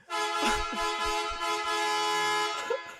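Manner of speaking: high airy laugh; a high laugh with barely any voice in it; high breathless laugh. Real, not acted. Host cracking up over the horn, high breathless laugh.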